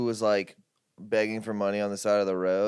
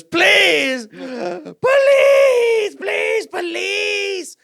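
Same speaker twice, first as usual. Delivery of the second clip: very high, loud stretched wails; imitated screaming plea; 11 dB louder, much slower than usual. Homeless woman calling after stranger, screaming plea. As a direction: scream after someone walking away, hammed up.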